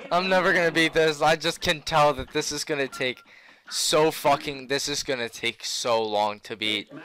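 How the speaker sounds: usual speaking voice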